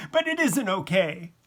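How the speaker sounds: high-pitched, distressed objection; upset protest. A narrator's reading. Crying wife answering her husband's comfort, upset.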